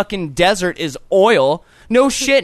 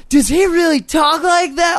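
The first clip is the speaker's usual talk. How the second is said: high, amazed imitation of a friend asking; mock disbelief; 9 semitones higher than usual. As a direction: play up the friend's stunned question. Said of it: "you were all like".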